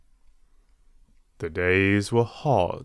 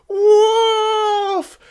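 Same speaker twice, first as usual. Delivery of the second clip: high, loud panicked cry; desperate shout; 6 dB louder than usual. A narrator's reading. Boy sees a real wolf, loud panicked high shout. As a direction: scream for real help. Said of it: "shouting as loud as he could".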